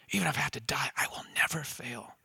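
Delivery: very soft, sinking almost to a whisper; hushed vow. Acted. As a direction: let the promise drop away to a near whisper. Peter's vow repeated, hushed.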